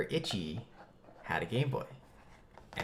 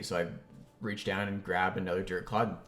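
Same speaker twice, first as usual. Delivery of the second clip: ordinary talk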